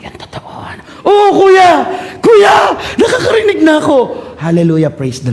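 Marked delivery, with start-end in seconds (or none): 0.0-0.8 whisper. Retold or imitated. Testing deaf girl's hearing. 1.1-4.0 high, loud joyful cry; joyful shout. Retold or imitated. Healed girl shouts to the preacher that she hears.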